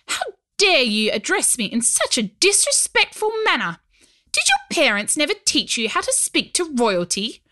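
put-on high, indignant royal girl; haughty princess scolding. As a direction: scold him like a spoiled princess. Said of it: "she growled".

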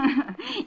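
short high scornful laugh; high mocking laugh. Exposed impostor laughs at the fooled detective. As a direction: laugh in his face, high and amused.

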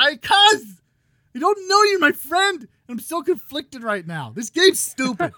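real frustrated yelling that is straining the voice; angry yelling. Real, not acted. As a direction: yell until the voice cracks. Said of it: "This is how angry I am".

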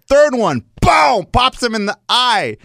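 high, loud, punchy play-by-play; excited fight recap. Retold or imitated. Telling coach about the big blow, excited. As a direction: hit the boom big and stretch it.

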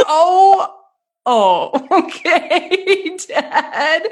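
high, laughing oh-okay; laughing disbelief. Retold or imitated. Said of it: "I was like".

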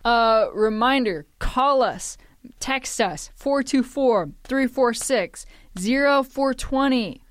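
slow, loud promo read; announcer read. Acted. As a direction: read it like a radio plug. Reads the show phone number.

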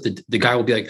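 ordinary talk

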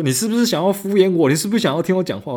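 mock high accusing caller; accusing customer. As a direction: shoot it up high, indignant. Customer accuses agent of brushing off.

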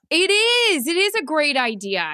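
high, loud eager agreement; excited. Real, not acted. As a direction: insist it's a great idea. Emphatic agreement.

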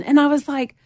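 ordinary talk, nothing marked

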